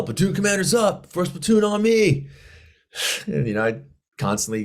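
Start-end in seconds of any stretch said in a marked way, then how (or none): 0.0-2.3 high, carrying parade-ground call; yelled command. Retold or imitated. Lieutenant calling his platoon together, yelling. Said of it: "I would yell".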